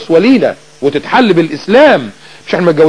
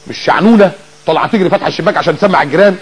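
usual speaking voice

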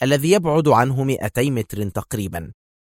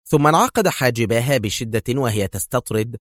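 usual speaking voice

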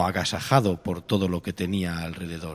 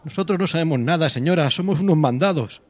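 high, loud defensive voice; defensive protest; 8 semitones higher, 7 dB louder than usual. Acted. Deliverers answering an angry lady, high loud protest.

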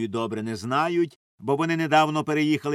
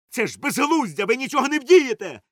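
high-pitched loud protest; raised, exasperated cry; shouting; 11 semitones higher, 6 dB louder than usual. A narrator's reading. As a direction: shout to stop someone leaving. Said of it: "кричав собі пан Валер'ян".

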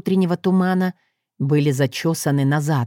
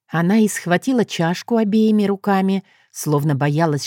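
ordinary delivery

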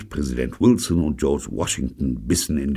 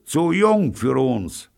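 calling across; 6 dB louder, slower than usual. A narrator's reading. Shouting across street.

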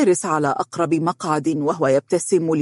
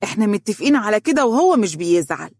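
put-on higher-pitched cheeky child; mischievous; 5 semitones higher, faster than usual. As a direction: answer back like a naughty little kid. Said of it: "قائلة بمشاغبة".

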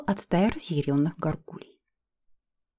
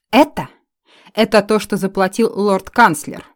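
loud, slow furious elf; angry outburst; 9 dB louder than usual. Acted. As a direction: spit it out in outrage.

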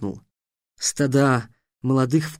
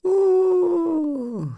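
theatrical high cry. A narrator's reading. Sphinx exclaims.

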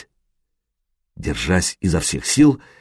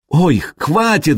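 put-on slow, high begging girl; pleading; 7 semitones higher, slower than usual. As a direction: beg him to stop. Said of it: "взмолилась Буданка".

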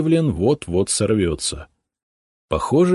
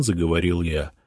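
plain reading voice

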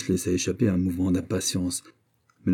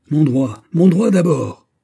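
loud, high, impetuous outburst; cri fougueux; 7 semitones higher, 8 dB louder, slower than usual. A narrator's reading. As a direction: cry it out, building on the repeat. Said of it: "s'écria le fougueux jeune homme". (s'écrier avec fougue)

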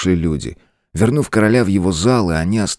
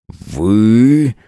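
shocked, louder and higher hiss; 5 semitones higher, 5 dB louder than usual. A narrator's reading. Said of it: "шокированно прошипел демон".

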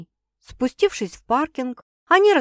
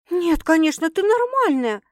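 high-pitched indignant protest; protest, voice shooting up; 8 semitones higher, 3 dB louder than usual. A narrator's reading. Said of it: "возмутилась девушка". (возмущённо, на высоких нотах)

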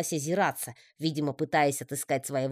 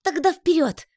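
радостный клич; 7 dB louder than usual. A narrator's reading. Паучок радостно зовёт в путь.

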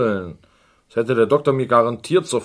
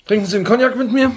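suddenly bright offer; 12 semitones higher, 6 dB louder, much faster than usual. Acted. Offering cognac, sudden shift.

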